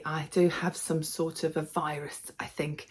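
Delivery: sick voice fading to a whisper; hoarse near-whisper. Real, not acted. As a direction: voice dropping to a rough, breathy whisper. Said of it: "I do sound a little bit ropey".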